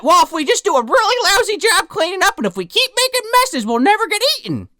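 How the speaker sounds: high-pitched mock scheming boy; imitated Simon. Simon plotting to avoid being eaten, high mock scheming. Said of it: "Simon, to his credit, has a pretty good idea".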